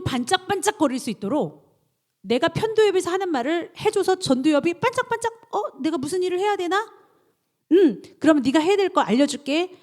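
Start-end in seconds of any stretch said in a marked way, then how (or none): 5.5-9.8 eager brain voice. Acted. Voicing the eager frontal lobe.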